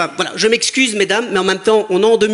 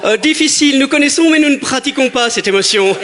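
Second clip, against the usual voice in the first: mock pompous; 4 semitones higher than usual. Mocking a pompous heckler.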